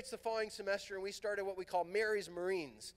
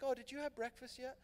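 put-on higher, softer girl's voice; sweetly ask, lightly voicing a young woman; a light imitation of a sweet girl's question; voicing Blanche; 6 semitones higher, 4 dB quieter than usual.

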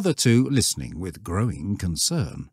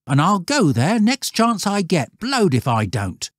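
higher, defiant schoolboy scoff; scoffing boy; 11 semitones higher, 4 dB louder than usual. A narrator's reading. Stanley scoffing at the shepherd, higher and defiant. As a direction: toss it off like a cocky boy.

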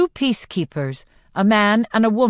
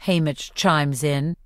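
plain reading voice

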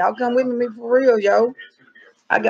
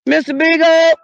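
higher, louder call, second one slow; calling the dog; 5 semitones higher, 4 dB louder than usual. Pretend. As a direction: call twice into the woods.

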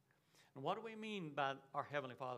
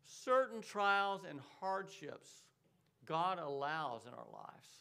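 every word set down slowly; slow right down so it sinks in; slow, weighty; 3 dB louder, slower than usual. Real, not acted. Pastor slowing down after calling out "listen".

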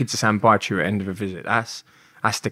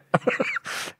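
a quick high airy laugh; breathy laugh; 6 dB quieter than usual. Real, not acted. Laughing at his own near-death story.

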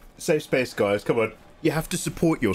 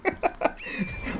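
real high, breathless giggle; high laugh; 5 dB quieter than usual. Real, not acted. Streamer tickled by chat's banter, high giggling. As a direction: burst into helpless high laughter.

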